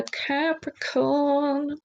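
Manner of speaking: high, slow lilt on Capricorn; stretched playful star-sign; odd sing-song Capricorn. Real, not acted. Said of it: "I don't know why I did that".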